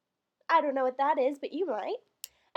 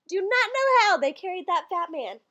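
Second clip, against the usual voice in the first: ordinary talk, nothing marked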